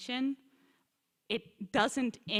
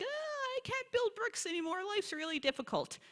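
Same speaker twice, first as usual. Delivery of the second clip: high, mocking whine of complaint; a high whiny voice mocking people who grumble about their lot; 12 semitones higher than usual. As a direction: whine it like a put-upon grumbler. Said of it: "they hear it as complaint".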